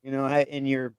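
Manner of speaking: normal talking voice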